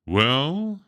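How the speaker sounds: drawn-out, hesitant well; very slowly. Acted. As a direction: hold it, reluctant to answer. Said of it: "said William very slowly".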